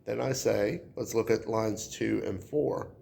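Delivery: normal talking voice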